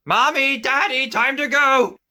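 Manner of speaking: high loud call to parents. Acted. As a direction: shout to the parents urgently. Chihiro calling parents.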